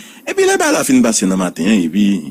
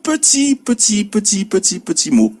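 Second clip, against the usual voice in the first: voix montée très haut, lente, sur « petit, petit »; étirer chaque « petit » pour souligner la petitesse; insistance aiguë; 12 semitones higher than usual. Shown as a demonstration. (insistance aiguë et traînante sur « tout petit mot »)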